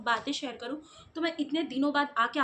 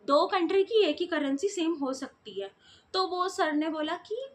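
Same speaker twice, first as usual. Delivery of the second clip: normal talking voice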